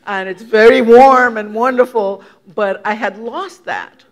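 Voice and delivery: usual speaking voice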